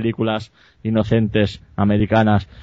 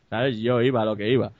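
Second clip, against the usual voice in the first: ordinary talk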